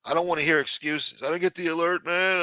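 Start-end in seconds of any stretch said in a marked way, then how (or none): 1.2-2.4 mocking excuse. Mocking absent viewers' excuses.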